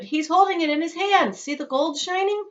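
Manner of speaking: high, excited crowd voice; excited bystander. Acted. Bystander tells the crowd about the shining gold, excited. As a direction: share the thrill of the gold.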